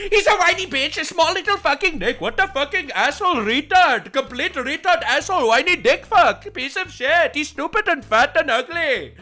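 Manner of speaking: ranting in a mock Canadian accent; a fake Canadian accent on the rant. Vlogger mocking the interviewee in a Canadian accent. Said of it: "I say in my best Canadian accent".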